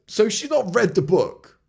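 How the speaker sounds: high, loud disbelief on NOT; incredulous. Real, not acted. Vlogger reacting to his critic's message, incredulous. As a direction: stress the not in shock.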